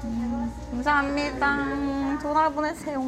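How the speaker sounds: shy mumble with pursed lips; mumbling into a pursed mouth; timid mumbling. Real, not acted. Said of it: "정말 소심하게 웅얼웅얼거리면서".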